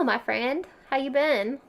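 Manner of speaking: ordinary talk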